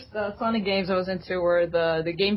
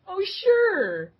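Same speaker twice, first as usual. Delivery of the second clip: mock breezy reply; 12 semitones higher, 4 dB louder than usual. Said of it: "It's like".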